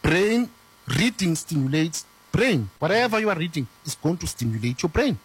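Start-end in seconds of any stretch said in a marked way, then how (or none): none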